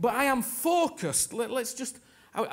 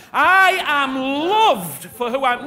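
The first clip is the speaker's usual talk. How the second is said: slow, loud proclamation; loud declaration; 2 semitones higher, 9 dB louder than usual. Shown as a demonstration. Preacher urging congregation, repeating louder. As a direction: proclaim it boldly for the church. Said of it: "let's do it again".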